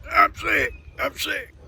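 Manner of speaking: high, whiny moan of "I'm sick"; imitating sick Doc. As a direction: whimper it like a miserable sick man. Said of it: "try to sound exactly like he sounded".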